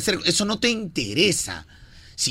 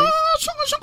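coy falsetto; faster than usual. Pretend.

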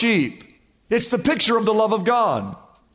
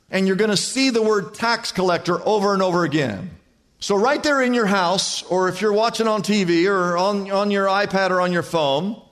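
ordinary talk

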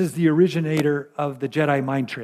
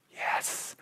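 a soft, near-whispered yes; said gently; 14 dB quieter than usual. Acted. Jesus answering the pastor with a gentle whispered yes. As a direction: breathe the yes out gently, with no force. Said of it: "But he says it gently, not forcefully".

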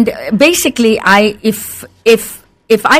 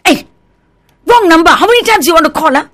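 rude; 7 semitones higher, faster than usual. Acted. Person getting wrong-number calls, rude and irritated. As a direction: snap impatiently at a repeat wrong caller. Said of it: "people sometimes tend to be rude".

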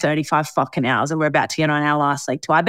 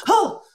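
high shocked "oh", imitated; mock gasp of shock; short high gasp, acted out; taken-aback gasp. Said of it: "taken aback".

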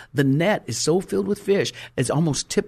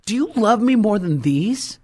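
insistent question; 6 semitones higher, 5 dB louder than usual. Retold or imitated. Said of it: "He's pointing at the fish net".

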